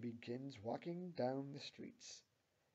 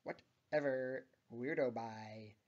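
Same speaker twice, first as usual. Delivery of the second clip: slow, drawn-out annoyed brush-off; frustrated, annoyed; 7 dB louder, slower than usual. A narrator's reading. Tammy walking away from Louise, frustrated and annoyed. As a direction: dismiss them, dragging the words in irritation. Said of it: "in a frustrated yet annoyed manner".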